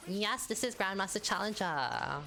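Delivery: lightly sarcastic demo; mock sarcastic take. Shown as a demonstration. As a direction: let it sound a little sarcastic. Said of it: "Oh, that's, that's sarcastic".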